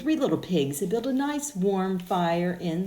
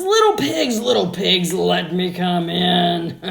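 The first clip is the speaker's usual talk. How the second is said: the big bad wolf demanding to be let in; 11 dB louder than usual. Wolf at brick house.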